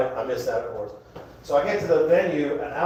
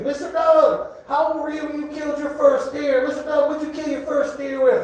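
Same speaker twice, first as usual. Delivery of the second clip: put-on high, eager kid voice; child voice; 12 semitones higher than usual. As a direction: ask it like a curious little kid. Grandkids asking questions.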